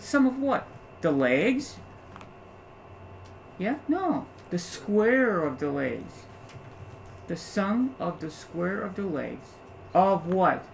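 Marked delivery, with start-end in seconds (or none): 4.5-9.5 slow emphasis. Shown as a demonstration. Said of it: "teacher stressing correct phrasing".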